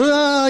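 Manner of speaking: high, drawn-out mock prophetic cry; wild mock wail. Person standing up all wild, mock wailing. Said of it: "stands up all wild and whatnot".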